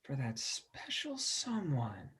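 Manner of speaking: very soft, slow and dreamy; dreamy whisper. Acted. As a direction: murmur it to yourself, awestruck. Buddy reads the sign.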